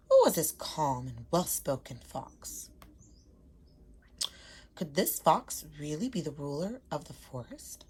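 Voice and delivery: calm read-aloud storytelling voice; story narration. A narrator's reading. Teacher reading a picture book to her class, calm storytelling. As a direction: read it gently to young listeners.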